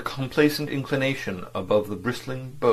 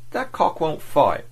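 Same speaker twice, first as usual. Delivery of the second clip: put-on slow English accent, pitched up; 7 semitones higher, 4 dB louder, slower than usual. A narrator's reading. Diplomat retorting to his agent in an English accent. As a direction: drop into a genuine English accent, slowly. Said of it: "amazingly genuine English accent".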